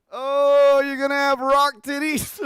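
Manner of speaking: high, very loud, laughing cry; shrieking with amusement; hysterical mock wail. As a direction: wail it while cracking up. Hysterical teasing of the actress.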